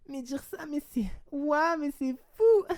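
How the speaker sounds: very high, mock amazed squeal; giggly disbelief. Girl reacting to the screens she was sent, mock giggly amazement. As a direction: imitate her loud, high-pitched amused gasping. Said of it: "elle rigolait, elle était en mode".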